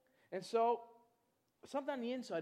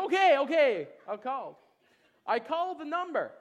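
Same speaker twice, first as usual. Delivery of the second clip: caving in loudly; 6 semitones higher, 14 dB louder than usual. Retold or imitated. Giving in to pushy friend.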